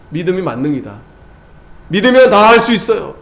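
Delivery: ordinary delivery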